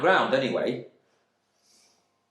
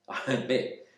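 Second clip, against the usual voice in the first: plain reading voice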